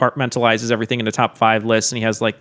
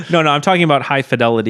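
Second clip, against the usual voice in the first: ordinary talk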